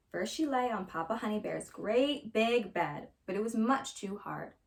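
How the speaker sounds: ordinary delivery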